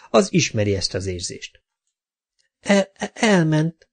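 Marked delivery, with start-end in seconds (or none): 2.6-3.9 halting, high-pitched stutter; high stuttered false starts; stammering. A narrator's reading. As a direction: stammer on the first syllable before getting it out. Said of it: "dadogta Bee".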